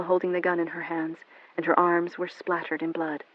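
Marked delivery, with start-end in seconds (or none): none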